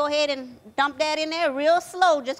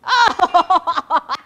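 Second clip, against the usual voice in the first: loud laughter; 3 semitones higher, 6 dB louder than usual. Real, not acted. Laughing at Danny throwing.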